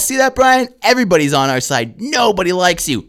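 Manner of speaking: high, loud, emphatic berating; re-enacted heckler takedown. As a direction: lay into a heckler from the stage. Acts out berating the heckler onstage.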